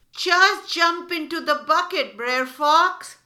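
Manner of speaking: high, loud eager rabbit voice; coaxing rabbit. Acted. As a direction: urge the fox to jump, all friendly. Rabbit lures fox into bucket.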